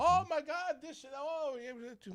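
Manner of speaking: very high, squeaky babble; imitated flustered chatter in falsetto; mock fuss. Mimicking bustle on arriving home.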